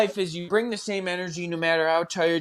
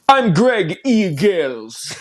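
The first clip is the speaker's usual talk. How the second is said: put-on Jamaican accent; 5 semitones higher, 6 dB louder, much slower than usual. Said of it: "try to do a Jamaican accent".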